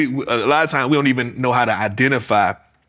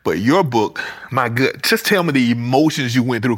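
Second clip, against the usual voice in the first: ordinary talk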